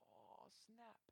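very soft, understated oh-no; hushed mock dismay; stunned realisation. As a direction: let it out quietly, dumbfounded. Acting Saul's stunned realisation.

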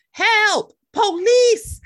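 high, loud mock cries for rescue; guy crying for help. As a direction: call out like a hammed-up victim. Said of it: "I'm like that guy in the".

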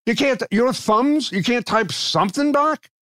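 high-pitched exasperated complaint; worked-up, incredulous griping. Real, not acted. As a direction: complain in disbelief, pitch jumping up. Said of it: "that makes me crazy".

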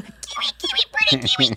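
tiny, very high bird voice; parakeet imitation. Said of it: "he just was like".

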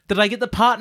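usual speaking voice

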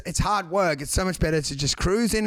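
ordinary talk, nothing marked

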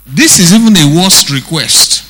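ordinary delivery

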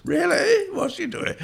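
very high mock interest; Francis Bacon. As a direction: imitate an arch query in a high voice. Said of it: "Francis Bacon says".

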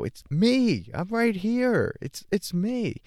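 insisting it's me. Retold or imitated. The ego answering the teacher, in a high mock voice. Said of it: "And we go".

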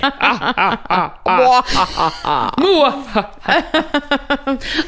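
long, hammed-up villain cackle; playful mad-scientist laughing; mock maniacal laugh. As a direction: draw out a mock evil "mwahaha". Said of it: "maniacal laugh".

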